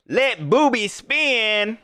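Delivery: slow, drawn-out quote of a fan's slogan; quoting a viewer. Retold or imitated. Streamer repeating a fan's slogan back to him, slowly. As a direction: stretch the words out like a catchphrase. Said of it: "Bird Gang told me that".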